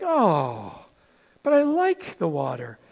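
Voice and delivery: low, soft, let-down sigh of a line; lightly acted wistful protest; mock disappointment. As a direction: sink down softly as if let down. Acts out disappointed reaction to no seas.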